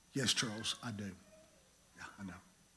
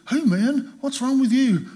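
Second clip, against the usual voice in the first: roommate Dwayne; 9 semitones higher, 6 dB louder than usual. Retold or imitated. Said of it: "And Dwayne said".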